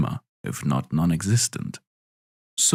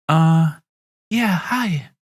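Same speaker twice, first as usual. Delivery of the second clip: hesitant, higher nervous hello; awkward; 8 semitones higher, much slower than usual. Acted. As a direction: stumble over an awkward greeting. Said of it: "Sarthakai says awkwardly".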